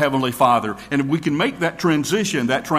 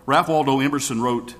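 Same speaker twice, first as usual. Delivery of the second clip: normal talking voice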